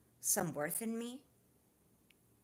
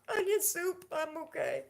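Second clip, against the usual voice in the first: much higher hammed-up sob on "Onion soup"; mock crying; 11 semitones higher, 5 dB louder than usual. Friends' running joke, she answers with mock crying. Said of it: "running joke with my friends".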